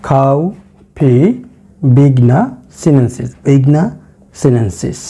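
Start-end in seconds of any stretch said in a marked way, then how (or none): 0.1-1.1 খাউফি drawn out slowly and clearly; slow model pronunciation. Shown as a demonstration. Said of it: "আমি বাংলায় উচ্চারণ করে লিখে রেখেছি". (ধীরে স্পষ্ট উচ্চারণ)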